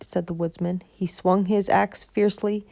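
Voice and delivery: plain reading voice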